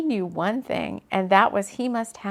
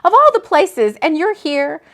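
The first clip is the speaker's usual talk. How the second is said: much higher, loud disbelief; disbelieving; 7 semitones higher, 9 dB louder than usual. A narrator's reading. Woman finding him at her brother's place, disbelieving. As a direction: blurt it out, astonished. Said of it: "She couldn't believe they were at her brother's place".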